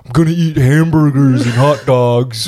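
high, slow exaggerated American accent; mock American accent. Mimicking foreigners mocking Americans, exaggerated American accent. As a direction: ham up a foreigner's idea of American speech. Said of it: "other people do American accents".

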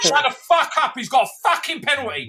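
mock rage screamed out; furious shout. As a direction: blow up at the posh lads. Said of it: "it's like".

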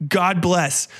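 ordinary talk

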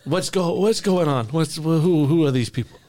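a pompous crime boss gloating to his crew; Tooth Fairy mob boss. Acted.

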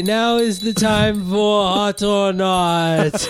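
loud, high, slow proclamation; town crier. Acted. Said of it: "Shut up, town crier".

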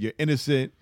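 usual speaking voice

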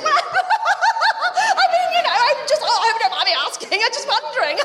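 very high, hammed-up flustered babble; mock existential crisis with nervous laughter; panicked crisis. Friend backpedalling after asking about the sperm, panicked and squealing. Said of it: "a crisis, an existential crisis of some sort".

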